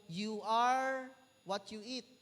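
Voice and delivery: drawn-out, deliberate slogan read; slow quoted slogan. Retold or imitated. Preacher quoting a slogan to the congregation, slow and deliberate. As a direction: spell out each word slowly like a motto. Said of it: "sabi na doon".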